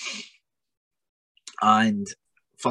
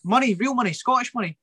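much higher, comic squeaky pal voice; imitating Lucha; 11 semitones higher than usual. Mocking his friend asking the Tesco cashier, high comic voice. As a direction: play the clueless mate, pitched way up. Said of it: "And he went".

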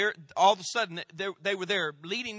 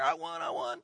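put-on excited little girl; 10 semitones higher than usual. Little girl jumping, winning a bike.

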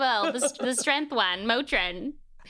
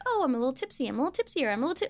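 higher, light tipsy sing-song; mildly tipsy voice; 5 semitones higher, much faster than usual. Acted. Acting out how alcohol feels, lightly tipsy. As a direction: play someone just slightly tipsy. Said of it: "Like, alcohol is like".

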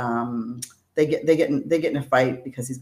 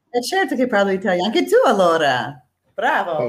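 quick, lively Italian; speaking Italian; 3 semitones higher, 3 dB louder than usual. Shown as a demonstration. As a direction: rattle it off in Italian. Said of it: "can you say something in Italian".

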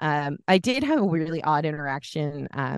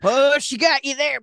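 mock crowd taunt; 9 semitones higher, 5 dB louder than usual. Crowd at bar reacting.

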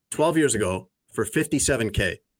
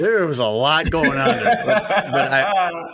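laughing while talking, high and loud; real laughter through the words; 7 semitones higher, 4 dB louder than usual. Real, not acted. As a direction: break up into laughter mid-sentence.

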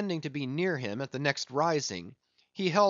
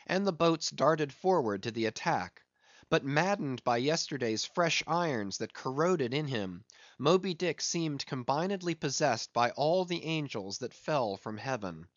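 ordinary delivery

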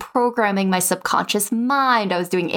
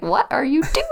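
higher, bewildered mother's question; baffled mom; 6 semitones higher than usual. Retold or imitated. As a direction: play the puzzled mother. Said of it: "And she's like".

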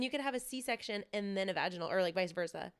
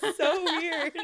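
laughing at how odd it is; 8 semitones higher, 7 dB louder, much slower than usual. Real, not acted. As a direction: say it through a laugh. Laughing reaction.

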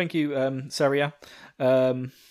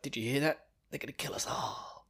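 fearful whisper; 2 semitones lower, 11 dB quieter than usual. Retold or imitated. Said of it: "on the floor saying".

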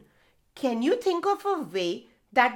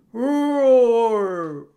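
long loud far-off roar; tiger roar; 8 dB louder than usual. Acted. The tiger roars to scare the mouse deer, loud roar.

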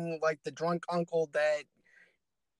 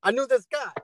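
higher, louder goofy voice; goofy guy voice; 6 semitones higher, 4 dB louder than usual. Retold or imitated. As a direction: ham up a know-it-all guy. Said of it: "imitating a character type".

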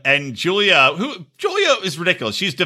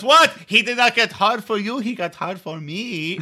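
mock indignant boasting; 5 semitones higher than usual. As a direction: imitate a rival woman showing off. Cuban girl gloating in imagined fight.

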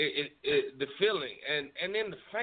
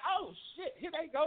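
surprised; 10 semitones higher, 6 dB quieter than usual. Retold or imitated. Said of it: "I was like".

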